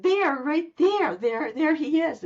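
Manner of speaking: high, slow, insistent pointing; excited pointing. Retold or imitated. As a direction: point him out, almost crying out. Pointing out the tall man.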